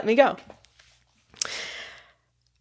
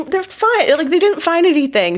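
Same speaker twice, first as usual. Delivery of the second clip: pitched way up, breezy and fake-calm; high nervous reassurance; 11 semitones higher than usual. To the panicking roommate, a high fake-casual reassurance. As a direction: sell the lie brightly to the worried roommate.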